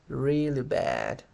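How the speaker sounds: slow, dragged-out glum answer; demonstrated dejection, very slow; sad, depressed. Shown as a demonstration. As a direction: stretch it out as if depressed. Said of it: "Suenen así, suenen tristes".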